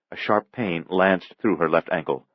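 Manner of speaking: ordinary delivery, nothing marked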